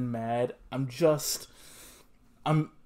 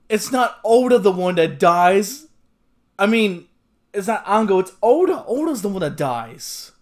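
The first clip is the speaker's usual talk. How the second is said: loud disbelief; 3 semitones higher, 12 dB louder than usual. Real, not acted. Realizing the character who dies.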